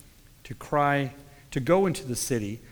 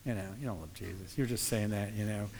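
scoffing; 4 semitones lower, 8 dB quieter than usual. Retold or imitated. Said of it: "I look at that sometimes and I go".

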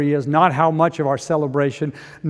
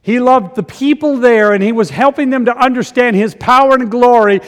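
high, loud, driving sermon voice; full-force preaching; 8 semitones higher, 11 dB louder than usual. Real, not acted. Preacher tells of Lazarus in heaven four days, full-force preaching. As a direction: proclaim it to the whole congregation with force.

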